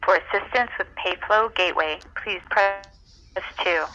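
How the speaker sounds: normal talking voice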